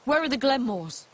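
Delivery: calling out. Acted. Searching for the Glenmores, calling out. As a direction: call out for anyone to answer.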